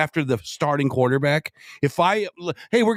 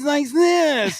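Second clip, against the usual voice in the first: high, slow whiny mimicry; mock a whining politician in a raised pitch; 12 semitones higher than usual. Co-host showing how the candidate says things, whiny imitation. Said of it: "He needs to stop being so whiny".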